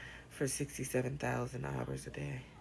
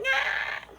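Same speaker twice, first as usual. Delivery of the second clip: mock cry; 5 dB louder than usual. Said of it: "be like".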